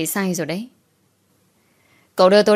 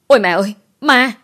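high, loud frightened shriek; startled scream; 4 semitones higher, 6 dB louder than usual. A narrator's reading. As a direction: cry out in sudden fright. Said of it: "Tôi giật mình rồi hét lên". (hét lên hoảng sợ)

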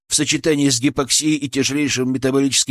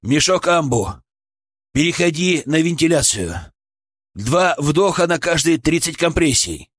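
raised, firm orders; commanding; 4 semitones higher than usual. A narrator's reading. Medic orders ventilation during compressions, commanding. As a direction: direct the team urgently. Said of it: "командовал я".

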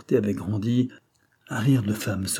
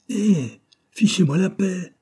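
high-pitched outburst of lost patience; exasperated shout; 9 semitones higher, 4 dB louder, slower than usual. A narrator's reading. Young master to a pestering artist, exasperated cry. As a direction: snap out loudly, at the end of your patience.

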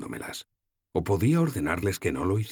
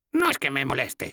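put-on much higher, wry old woman's voice; voiced little old woman, amused; old goddess's voice; 9 semitones higher than usual. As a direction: tease the kissing couple.

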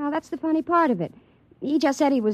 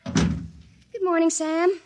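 slow, higher, sweet hello; sweet greeting; 5 semitones higher, much slower than usual. Acted. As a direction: greet him slowly and sweetly.

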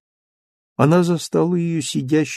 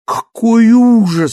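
slow, high, loud cry of horror; horrified cry; 9 semitones higher, 6 dB louder, slower than usual. Acted. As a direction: recoil in horror. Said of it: "вскричала Фантина". (в ужасе вскрикнув)

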